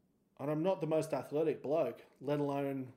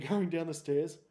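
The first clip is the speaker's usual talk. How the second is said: normal talking voice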